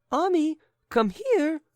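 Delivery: high, loud call to the dog. Acted. Lady calling her little dog, high and loud. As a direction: call the dog sharply from across the room. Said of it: "cried the lady".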